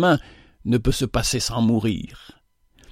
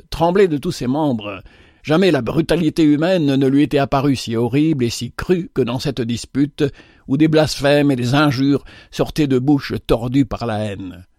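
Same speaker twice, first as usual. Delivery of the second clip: ordinary delivery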